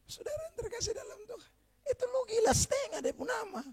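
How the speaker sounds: high breathless laughing aside; laughing in disbelief. Real, not acted. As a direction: crack up at the absurdity. Pastor breaks into incredulous laughter.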